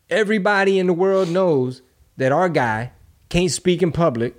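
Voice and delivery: ordinary talk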